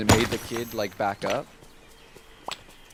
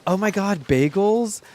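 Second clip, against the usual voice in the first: excited; 7 semitones higher, slower than usual. Retold or imitated. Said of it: "I was like".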